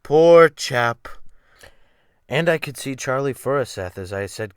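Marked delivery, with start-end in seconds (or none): 0.0-1.1 loud, slightly higher cry of pity; pitying exclamation. Retold or imitated. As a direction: exclaim it as club fellows mourning a lost friend. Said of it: "and saying".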